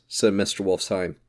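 usual speaking voice

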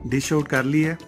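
normal talking voice

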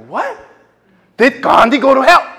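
loud, high disbelieving repeat; stunned echo. Retold or imitated. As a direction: throw it back in shock. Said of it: "I was like".

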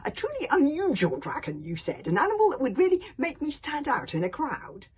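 put-on loud, high aggrieved boy; indignant young complaint; complaining. A narrator's reading. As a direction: throw the promise back at him, loudly. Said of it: "complained Hiccup".

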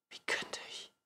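very soft whisper; whispered answer. A narrator's reading. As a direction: breathe the reply quietly. Whispered answer in the dark bedroom. (geflüsterte Antwort)

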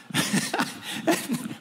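high airy burst of laughter; retold laughter. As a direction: break into a high breathless laugh. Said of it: "he started to laugh".

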